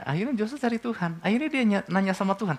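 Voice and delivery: ordinary talk, nothing marked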